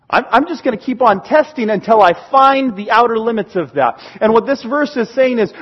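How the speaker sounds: normal talking voice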